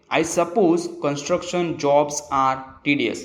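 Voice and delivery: very slow, carefully spaced English sentence; slow example reading. Shown as a demonstration. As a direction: read the example slowly for learners. (धीमे-धीमे उदाहरण पढ़ना)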